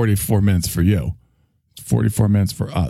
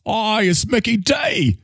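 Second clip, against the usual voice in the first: excited call; 10 semitones higher, slower than usual. Acted. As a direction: announce the arrival with gusto. [name] calls out Mickey's arrival.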